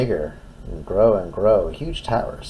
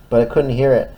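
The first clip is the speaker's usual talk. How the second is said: plain reading voice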